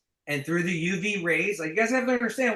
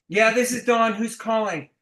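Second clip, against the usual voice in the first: prospect answering; 4 semitones higher, 5 dB louder than usual. Retold or imitated.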